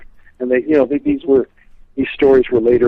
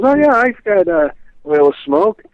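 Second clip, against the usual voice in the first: apothecary clerk; 6 semitones higher, 3 dB louder than usual. Retold or imitated. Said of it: "and he says".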